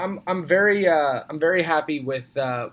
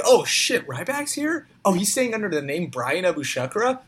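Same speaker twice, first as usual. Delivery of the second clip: high, incredulous front-desk clerk; mock astonishment; 5 semitones higher than usual. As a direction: squeak in pretend disbelief at the guest. Acting startled hotel front desk.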